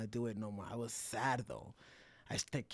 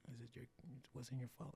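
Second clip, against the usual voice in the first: soft reassurance; 13 dB quieter than usual. Retold or imitated. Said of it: "some niño. And he was like".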